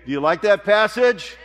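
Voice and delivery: loud, high call to the congregation; shouting to crowd. Real, not acted. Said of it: "I do a little better than that".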